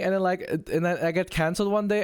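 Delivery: ordinary talk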